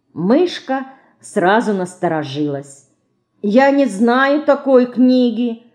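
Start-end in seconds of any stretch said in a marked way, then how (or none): 3.4-5.6 slow, higher-pitched puzzlement; surprised little girl, drawing it out. A narrator's reading. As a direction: say it with mild astonishment. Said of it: "с удивлением сказала она".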